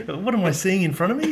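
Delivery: giggling imitation of a baffled umpire; laughing question. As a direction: ask it mid-laugh, baffled. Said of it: "it's like a question".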